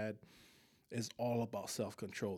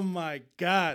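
ordinary talk

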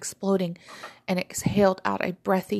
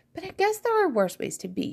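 higher, lightly voiced inner thought; smiling thought; 10 semitones higher than usual. A narrator's reading. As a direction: muse it with a smile. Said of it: "she thought as she smiled".

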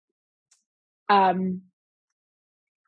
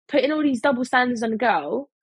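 ordinary talk, nothing marked

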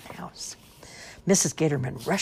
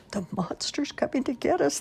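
high, hushed squeal of fright; mock scream; 12 semitones higher than usual. A narrator's reading. Gatorman kids hiding under covers, mock screaming. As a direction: squeal it without full volume. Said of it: "they all screamed".

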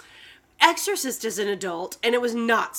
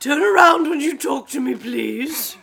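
raised, loud mock-royal order; the Queen; 4 semitones higher, 6 dB louder, slower than usual. The Queen ordering people to turn around, haughty mock-royal voice. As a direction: command it with put-on regal hauteur. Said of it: "I'm being the queen".

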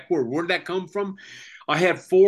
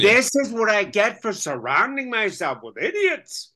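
higher, kvetching mother tone; 7 semitones higher than usual. Acted.

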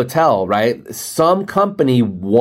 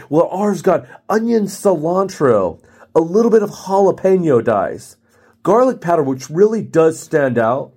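higher mock sales boast; clueless salesperson; 5 semitones higher than usual. Rep lists salsa ingredients to customer, hammed-up pitch. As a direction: savor each ingredient like an overeager rep. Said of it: "the salespeople who don't understand hints".

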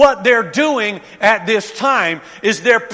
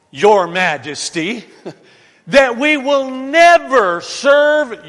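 defiant declaration; 3 semitones higher, 6 dB louder than usual. Acted. Voicing the three men before the king.

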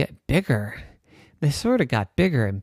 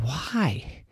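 whisper; 6 dB quieter than usual. Acted. Daddy asks why.

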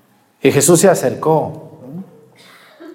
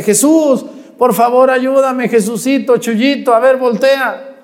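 higher-pitched imitation of a beggar calling out; raised, mildly hammed-up plea; mock pleading call; 7 semitones higher than usual. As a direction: play-act begging for someone's attention. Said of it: "¿Por qué le gritó".

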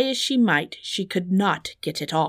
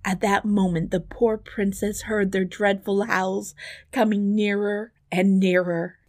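plain reading voice

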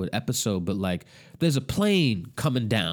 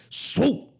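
high swooping whoosh, mouth sound; whoosh sound effect. Retold or imitated. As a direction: mimic a speedster zipping past. Whoosh of speedster passing.

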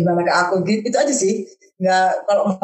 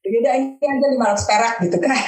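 usual speaking voice

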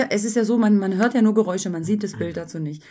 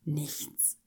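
barely audible; 6 semitones lower, 15 dB quieter than usual. Retold or imitated. Dead silence after the noise.

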